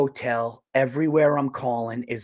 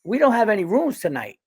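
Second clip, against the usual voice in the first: higher, lilting West Indian voice; mock West Indian refusal, pitched up; West Indian accent; 5 semitones higher, 3 dB louder than usual. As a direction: imitate a West Indian hotel clerk. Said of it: "How did she say it?".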